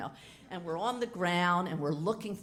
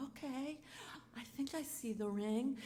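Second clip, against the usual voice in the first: hesitant quiet okay; 7 semitones higher, 7 dB quieter than usual. Retold or imitated. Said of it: "I go".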